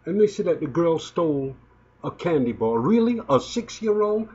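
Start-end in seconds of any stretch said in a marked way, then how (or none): 2.8-4.3 higher-pitched, disbelieving question; incredulous. Real, not acted. Incredulous at the candy theft accusation.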